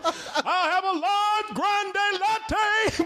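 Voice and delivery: high, slow, drawn-out put-on voice; a slow, high mock voice ordering coffee. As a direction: stretch every word in a high silly voice. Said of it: "why are you talking so loud".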